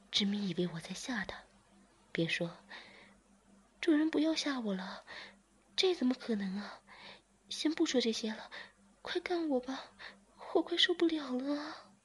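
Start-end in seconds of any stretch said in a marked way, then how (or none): 3.8-11.9 lifted, urgent pleading voice; beg, impatient and breathless; higher character voice. Acted. Zhiming begs master to stop teasing, urgent.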